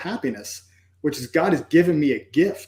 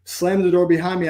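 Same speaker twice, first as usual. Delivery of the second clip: normal talking voice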